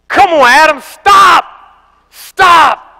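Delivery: high, loud pleading shout; panicked shouting. Retold or imitated. Little brother begging Adam to stop, high panicked shout. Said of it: "says this".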